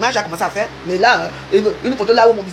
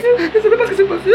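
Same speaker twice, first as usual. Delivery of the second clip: much higher, laughing through the line; 10 semitones higher, 3 dB louder than usual. Real, not acted. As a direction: crack up while saying it. Joking about her own youth. (éclat de rire aigu)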